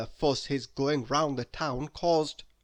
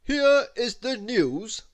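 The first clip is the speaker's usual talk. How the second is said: higher, louder, slow formal broadcast; newscaster announcing; 7 semitones higher, 6 dB louder, slower than usual. A narrator's reading. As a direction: announce it like the evening news. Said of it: "instead of saying".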